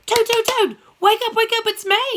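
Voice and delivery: high, loud wake-up call; excited calling Frog. Acted. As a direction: shout him awake with the news. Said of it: "finally he was like".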